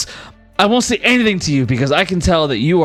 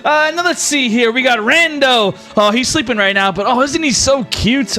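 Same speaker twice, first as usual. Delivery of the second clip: higher, playful teasing god; higher, cheeky showman deity; flamboyant god voice; 6 semitones higher than usual. Acted. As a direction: flirt and tease each hero like a party host. Rex greets each player.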